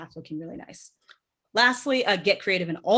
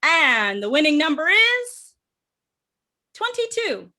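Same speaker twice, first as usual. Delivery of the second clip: raffle caller; 6 semitones higher, 4 dB louder, slower than usual. Acted. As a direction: build up then reveal the number high. Drum roll, please.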